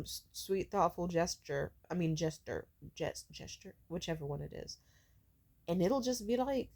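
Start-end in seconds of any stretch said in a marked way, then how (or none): 1.9-4.8 soft, unvoiced self-correction fading out; trailing mumble. Real, not acted. Unsure of a word, she trails off softly. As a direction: mutter the word to yourself, dropping away.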